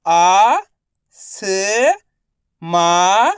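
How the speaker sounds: आ, स, मा each held long; letter-by-letter sounding. Shown as a demonstration. Teacher for pupils to repeat, आ, स, मा sounded alone. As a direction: stretch आ, स and मा separately. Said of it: "आपको मेरे साथ साथ रिपीट करना है".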